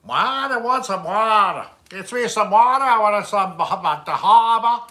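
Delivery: loud, high, hammed-up Boston accent; attempted Boston accent. Shown as a demonstration. Streamer on a dare asking for water, loud Boston accent. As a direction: belt out a broad mock Boston accent. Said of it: "try Boston".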